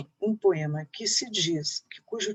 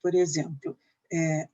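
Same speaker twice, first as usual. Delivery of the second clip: ordinary delivery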